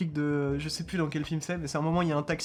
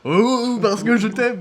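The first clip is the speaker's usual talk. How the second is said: put-on American accent; 5 semitones higher, 8 dB louder, much slower than usual. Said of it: "avec un accent américain".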